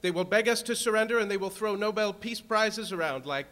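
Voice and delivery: building to a full-throated roar; roaring rallying speech. Real, not acted.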